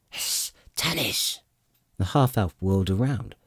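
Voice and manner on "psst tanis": slow, hushed call from the shadows; dwarf's hushed call. Acted. Dwarf signalling Tanis from the shadows, slow hushed call. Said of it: "a voice came from the shadows".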